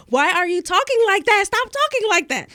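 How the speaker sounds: high, loud mock scolding mom. Retelling how her mom scolded her new way of speaking, high and loud. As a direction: squawk it like an exasperated mother. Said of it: "she was saying".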